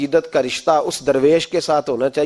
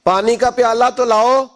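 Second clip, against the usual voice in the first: raised, higher call to a daughter; 7 semitones higher, 4 dB louder than usual. Retold or imitated. Father calls his daughter in the next room for water, calling out. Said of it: "آواز دی".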